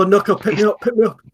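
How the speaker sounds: high, excited little plea; pleading little rat. Acted. Rat begs Noko for a lift, high pleading. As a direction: beg up at a friend in a high voice. Player voices rat begging pickup.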